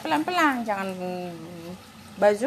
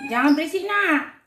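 scolding; 8 semitones higher, 6 dB louder than usual. Real, not acted. Scolding noisy dogs.

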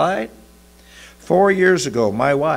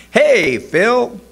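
high, loud call of greeting; shouted; 11 semitones higher, 7 dB louder than usual. A narrator's reading. Clerk greets a customer walking in, shouted. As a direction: shout a greeting across the shop. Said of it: "shouted the clerk".

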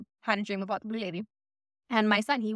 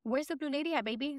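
put-on higher, soft sing-song baby talk to a toddler; grandma baby-talking her toddler granddaughter, higher and softer; 4 semitones higher, 6 dB quieter than usual. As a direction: coax it out like talking to a little one. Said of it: "baby talking with her".

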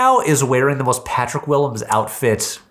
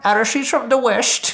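put-on Cork accent; 11 semitones higher than usual. Repeating the Cork West.

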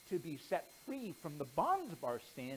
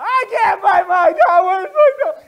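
loud wailing; 13 semitones higher, 25 dB louder than usual. Acted. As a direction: howl it like a child crying for her toy. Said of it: "the louder it got".

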